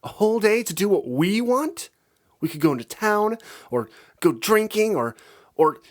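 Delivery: high, loud eager babble; gushing bat pony, breathless with ideas; excited rambling. A narrator's reading. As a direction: tumble out plans in a thrilled rush. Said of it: "She rambled on".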